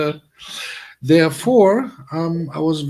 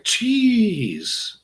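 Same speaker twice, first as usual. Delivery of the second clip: high, drawn-out "jeez"; mock-exasperated high cry of relief; retold exclamation; 12 semitones higher than usual. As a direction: stretch it out high, acted. Retelling his reaction after narrow escape.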